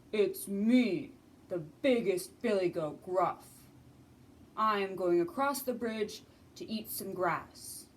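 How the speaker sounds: booming big billy goat; biggest goat. Acted. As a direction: answer like a huge, sure goat. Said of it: "he is so big".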